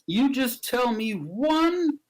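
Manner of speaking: high, very slow, drawn-out threat; threatening challenge. Acted. Man in store threatens to steal a picture, challenging. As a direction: stretch each word as a hammy ultimatum. Acting a menacing store robber.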